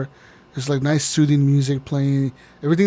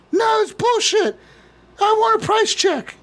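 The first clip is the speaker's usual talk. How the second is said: angry customer. Pretend. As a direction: caricature customer insisting on a price check. Angry customer demands price check.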